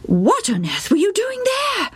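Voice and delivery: high, hushed mother's question; astonished mother. A narrator's reading. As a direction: gasp at the boy's folly. Mother asks.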